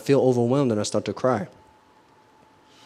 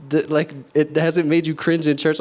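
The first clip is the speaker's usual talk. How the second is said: usual speaking voice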